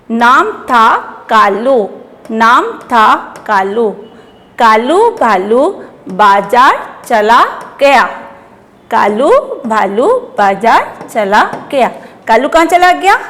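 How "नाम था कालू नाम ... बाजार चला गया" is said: word by word, long vowels stretched and rising; slow primer reading. Shown as a demonstration. Teacher reading for children to repeat, slow and segmented. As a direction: read slowly with a pause after each word.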